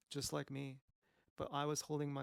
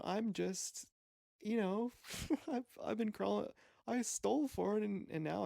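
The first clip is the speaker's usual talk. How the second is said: rising higher and louder, getting worked up; worked-up rant; 8 semitones higher, 4 dB louder than usual. Real, not acted. Confessing his desperation to her, voice climbing high and loud. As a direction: pitch climbing as he gets carried away.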